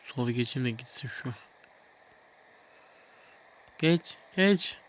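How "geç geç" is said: loud, high "go on, pass"; impatient urging. Real, not acted. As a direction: hurry them past, loud and sharp. Urging car to overtake.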